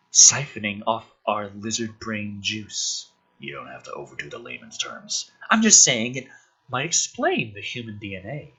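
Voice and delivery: hushed, low read-through of the film scene; quiet script reading. Acted. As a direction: murmur the characters' lines low.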